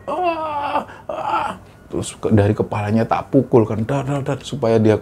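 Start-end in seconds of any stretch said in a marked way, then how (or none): 0.1-1.5 a shrill, high cry that quickly turns soft; high scream. Retold or imitated. The possessed girl shrieking as the prayer is read, high scream. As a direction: let out a sharp high shriek.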